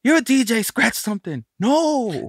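high-pitched, hammed-up bickering; pushy demand, then a high stubborn refusal; mock argument. Producer retelling the argument over scratching, mock bickering.

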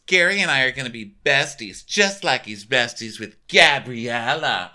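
high, loud sing-song mock; mocking Ashley. Podcaster mocking Ashley's line to Gary, high and sing-song. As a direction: ham up her smug flirting.

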